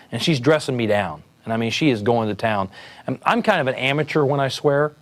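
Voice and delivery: ordinary delivery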